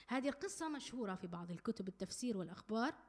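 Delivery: normal talking voice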